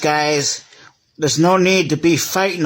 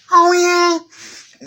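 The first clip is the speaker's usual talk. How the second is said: very high, squeaky "Oh, yeah?" dare; squeaky taunting toy; 13 semitones higher than usual. Pretend. One toy squeaking a dare at the train. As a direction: squeak it out like a cheeky little toy picking a fight. Toys quarrel in pretend play.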